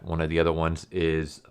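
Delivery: ordinary delivery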